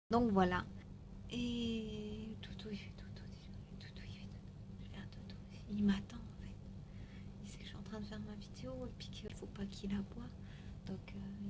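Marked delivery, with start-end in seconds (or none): 1.3-11.5 hushed whisper beside a sleeping dog. Real, not acted. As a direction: whisper so he won't wake. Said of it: "Je chuchote pour pas le réveiller".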